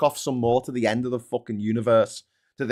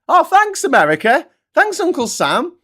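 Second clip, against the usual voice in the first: much higher and louder, dripping fake gratitude; sarcastic mock thanks; 8 dB louder than usual. Europe mockingly thanking America, a sarcastic gush. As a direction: gush thanks you clearly don't mean.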